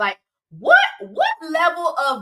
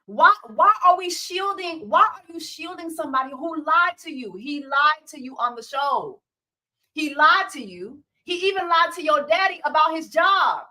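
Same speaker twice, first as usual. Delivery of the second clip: ordinary delivery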